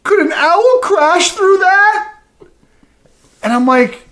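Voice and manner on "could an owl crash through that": put-on high, slow little boy question; worried kid. Boy asking his stepdad about the window, high slow child voice. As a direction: ask like a scared small boy.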